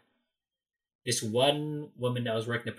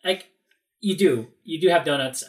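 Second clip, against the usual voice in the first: loud, raised-pitch retort; insistent contradiction; 5 semitones higher, 6 dB louder than usual. Retold or imitated. Visitor contradicts a vendor who denies having donuts, insistent. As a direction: argue back with emphasis on the denial.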